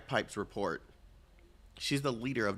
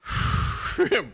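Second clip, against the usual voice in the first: a burst of breathy laughter; sudden loud airy laugh; 12 semitones higher, 9 dB louder than usual. Real, not acted. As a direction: crack up out loud. Streamer cracks up at game scene.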